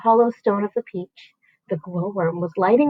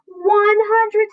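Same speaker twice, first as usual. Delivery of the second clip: high, loud, slow triumphant count; shouting; 11 semitones higher, 4 dB louder, much slower than usual. A narrator's reading. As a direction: shout the tally. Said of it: "he shouted".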